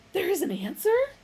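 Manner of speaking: thrilled disbelieving question; breathless excitement. A narrator's reading. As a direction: gasp it eagerly. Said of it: "with breathless excitement".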